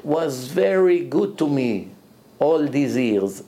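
slow, drawn-out and savoring; slow quoted English; slow quote. Retold or imitated. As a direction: linger on every word. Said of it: "אומר לי".

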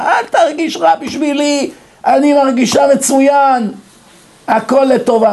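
high sing-out, mock cheery; cheerful retold reassurance.

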